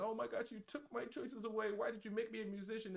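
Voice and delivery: high-pitched mock complaint; high put-on protest voice; complaining child. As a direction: imitate an aggrieved kid, way up high. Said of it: "people are like".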